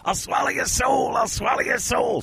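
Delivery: high shouted Evil Dead demon. Retold or imitated. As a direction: shriek it like a possessed demon. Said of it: "This is like an Evil Dead Two".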